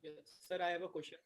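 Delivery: faint, nearly voiceless words; barely audible over a weak call line. Real, not acted. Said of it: "Can you speak a bit louder?".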